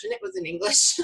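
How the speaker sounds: laughing through the line. Real, not acted. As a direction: break into laughter at the end. Laughing at own joke.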